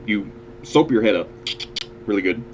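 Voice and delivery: normal talking voice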